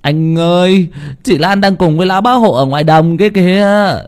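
put-on high, urgent child; high nervous kid; anxious boy. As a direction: blurt the bad news. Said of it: "mồ hôi đầm đìa".

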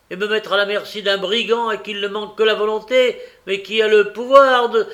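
high, loud rant building to a shout; breathless mounting fury; furious tirade. A narrator's reading.

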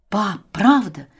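excited, eager questioning; admiring. Acted. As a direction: ask it full of wonder. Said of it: "восхищённо переспрашивает Вася".

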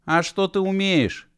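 high-pitched, sceptical call; challenging question. Acted. Girl to running Mashkov, high challenging question. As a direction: ask pitched far up.

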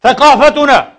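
ثقافتنا said high and loud, falling; emphatic pulpit accusation. Real, not acted. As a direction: hurl ثقافتنا out. Word said twice, loud second.

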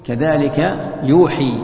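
يوحي ending in a long ii, slowly; with kasra. Shown as a demonstration. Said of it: "بالكسر".

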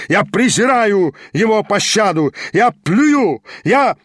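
high-pitched, mounting fury; raging. A narrator's reading. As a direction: rage with climbing pitch until cut off. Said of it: "бесновался Гаганов". (яростный крик)

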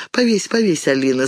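ordinary delivery

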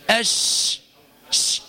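أش with air spreading through the mouth; shin with tafashshi. Shown as a demonstration. Teacher demonstrates the letter's quality, airy spreading sh. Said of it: "هكذا مع صفة التش-، التفشي".

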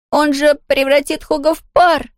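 high, desperate shout; desperate cry. A narrator's reading. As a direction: cry out in despair. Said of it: "в отчаянии крикнул Том". (отчаянный крик)

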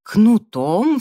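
high, loud startled question; startled, indignant query. A narrator's reading. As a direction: pipe up in bewildered alarm. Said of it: "вскинулся Джастин".

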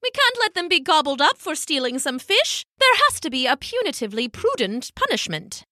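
high, protesting elder penguin; high-pitched prim character; Pippo. A narrator's reading. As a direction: object with fussy indignation. Pippo objects to the plan.

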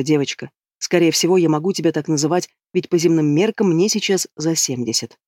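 plain reading voice

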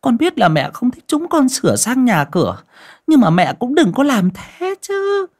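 high, mocking daughter-in-law voice; sarcastic. Daughter-in-law mocking her mother-in-law after the fire, high sarcastic voice. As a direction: sneer at the old mother with false sweetness. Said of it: "giọng mỉa mai".

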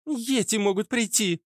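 put-on high girlish argument; arguing girl. Redhead warns of yetis coming, high and insistent. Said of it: "аргументировала рыжая".

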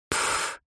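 short breathy scornful pff; contemptuous snort. Acted. Vampire scoffs at the idea of love with a snort. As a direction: blow out a dismissive snort. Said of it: "презрительно фыркнула".

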